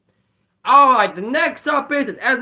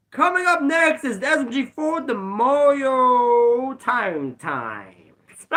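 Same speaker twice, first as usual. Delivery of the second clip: high, stretched-out announcer call; drawn-out showy episode intro; title announcement; 5 semitones higher, slower than usual. Acted. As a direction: announce it high, dragging out the final words.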